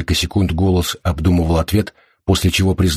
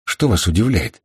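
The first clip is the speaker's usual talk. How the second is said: plain reading voice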